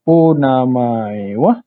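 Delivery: Onamae wa? said slowly with wa rising; slow onamae wa question. Shown as a demonstration. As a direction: ask Onamae wa? slowly and clearly. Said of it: "sebutan dia akan jadi". (tanya Onamae wa? perlahan dengan wa menaik)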